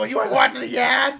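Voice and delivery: high, loud Boston tough guy with laughter; Boston accent, shouted; loud mock Boston. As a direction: yell it like a hammed-up Boston fighter.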